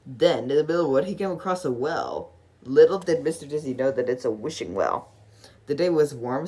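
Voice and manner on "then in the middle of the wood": plain reading voice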